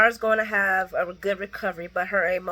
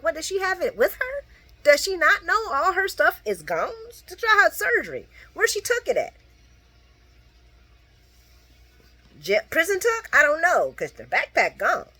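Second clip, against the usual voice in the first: high incredulous; 9 semitones higher, 4 dB louder than usual. Retold or imitated. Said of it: "I'm like".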